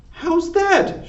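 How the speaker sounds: high, startled shout; Mig shouting. A narrator's reading. Mig shouting back at Cook across the kitchen, high yell. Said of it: "shouted Mig".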